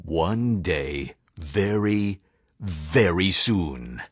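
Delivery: low, slow menacing vow; mean principal swearing revenge on George and Harold, low and menacing; villain vow. Acted. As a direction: growl the threat low and slow. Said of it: "Mister Krupp vowed".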